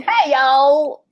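mock Southern drawl greeting; hammed-up cowboy hello; Southern twang. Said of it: "maybe I should start talking in some twang".

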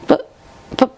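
high, stammering protest; Fluttershy, crying out. A narrator's reading. Distraught friend protesting to Twilight, crying out. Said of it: "she cried".

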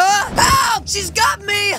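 panicked yell for help; shouting for help. Acted. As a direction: scream for help as she grabs you. Grabbed by the attacker.

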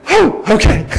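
hammed-up surprised husband; sudden realization. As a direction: catch on all at once. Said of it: "I was like".

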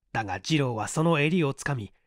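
ordinary delivery

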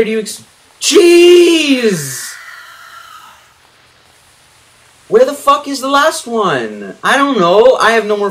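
high, loud, breathless panic; freaking out with the monster close by; frantic panic. Real, not acted. Lost, monster right next to her.

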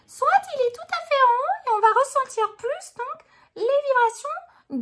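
very high, loud head voice. Shown as a demonstration. Teacher showing the larynx right up high, high head voice. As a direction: push the voice way up into the head.

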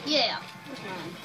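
normal talking voice

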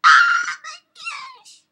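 very high, loud shriek; piercing game rage outcry; exasperated shriek. Real, not acted. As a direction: screech it in frustration. Frustrated outburst during game.

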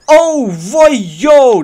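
high, very loud startled yells; super loud shouting. Real, not acted. Gamer sees it nearly work, super loud shouting. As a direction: burst out yelling at full volume. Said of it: "deed ik dat echt super hard schreeuwen".

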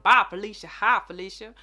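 loud playful shout; catchphrase call. Pretend. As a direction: throw out the catchphrase loudly.

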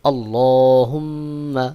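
slow আল্লাহুম্মা with a long hummed mm; ghunnah on meem. Shown as a demonstration. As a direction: hold the doubled m in আল্লাহুম্মা through the nose. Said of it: "মিম এবং নুনের উপরে তাসদিদ থাকলে ওয়াজিব গুন্নাহ".

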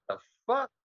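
high, incredulous what the fuck; mock shocked question; disbelief. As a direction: squeak it out in disbelief. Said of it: "Even I'm like".

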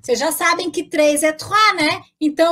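ordinary talk

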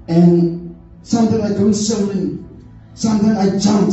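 ordinary talk, nothing marked

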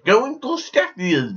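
slow, put-on Russian accent; exaggerated Russian accent. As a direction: stretch it out in a mock Russian accent. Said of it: "that is a better Russian accent".

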